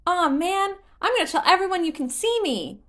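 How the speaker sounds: high, loud, fast and eager; excited child. Acted. Korok excited to tell friends, high fast childish voice. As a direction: blurt it like a kid with big news. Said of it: "the Korok said".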